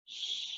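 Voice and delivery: very soft hissed sh; sh sound. Shown as a demonstration. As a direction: breathe out a quiet sh. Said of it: "spells the sound".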